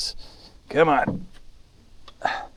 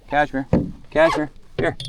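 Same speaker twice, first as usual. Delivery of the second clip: calling the dog; 7 semitones higher, 5 dB louder than usual. Real, not acted.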